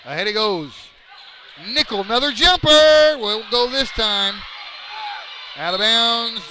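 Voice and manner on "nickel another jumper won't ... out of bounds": loud rapid-fire action call; excited play call. Real, not acted. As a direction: ride the action, voice up.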